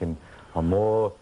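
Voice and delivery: put-on lightly Spanish-accented scolding; teacher sliding from the lesson into scolding Conan, light Spanish accent. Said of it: "He'd be like".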